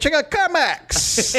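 high, slow ad announcer; commercial voice. Pretend. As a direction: pitch it like a TV spot. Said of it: "doing a Carmax commercial".

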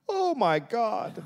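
slow, high, laughing mock cry; hammed-up dying gasp, drawn out; overwrought stagey melodrama. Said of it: "And Calic said, quote".

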